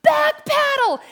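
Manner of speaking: high, loud guide's yell; yelled command. Retold or imitated. Guide to rafters heading toward the wall, yelling. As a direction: shout the order over the rapids. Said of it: "and she yells".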